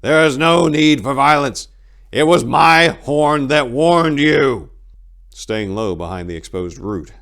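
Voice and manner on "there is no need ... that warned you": high, loud call across the forest; monk shouting to stop the archers. A narrator's reading. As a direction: bellow it so the hidden archers hear. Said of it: "he cried out".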